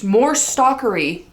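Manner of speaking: normal talking voice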